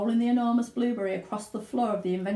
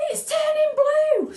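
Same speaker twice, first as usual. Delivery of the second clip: very high, loud, slow scream; 8 dB louder, slower than usual. Acted. Mother sees Violet turn blue, screaming. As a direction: scream it in horror. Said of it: "screamed Missus Beauregard".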